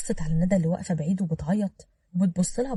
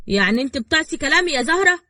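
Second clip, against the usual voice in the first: raised, loud, incredulous question; high and loud, affronted; indignant challenge; 8 semitones higher, 8 dB louder than usual. A narrator's reading. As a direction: confront her for disobeying you. Uncle challenges defiant niece.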